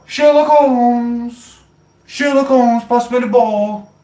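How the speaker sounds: high, loud, stretched football call; mock pitch shout. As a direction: bellow the name across the pitch. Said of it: "you don't go".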